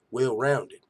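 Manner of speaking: ordinary talk, nothing marked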